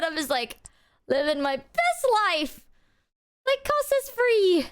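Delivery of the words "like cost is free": high, slow sarcastic cheer; mock celebration. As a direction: whoopee with fake glee. Mock cheer about free life.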